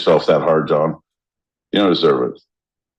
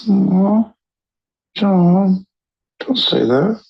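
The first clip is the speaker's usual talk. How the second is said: mock sympathy; 12 semitones higher, much slower than usual. Mock pity at gloomy remark.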